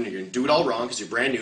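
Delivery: usual speaking voice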